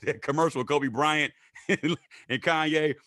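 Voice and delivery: ordinary talk, nothing marked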